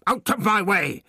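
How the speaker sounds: put-on high old man bursting out in rage; explosive rage. A narrator's reading. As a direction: explode at the guard. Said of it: "the doctor exploded".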